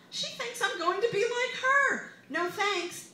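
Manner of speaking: loud high indignant outburst; loud scornful refusal; outraged. Acted. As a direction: fling it away with scorn. Mother's rising outrage.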